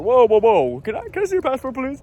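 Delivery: mock officious agent. TSA agent asking a traveler for his passport, mock officious alarm. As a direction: imitate an overzealous officer halting someone.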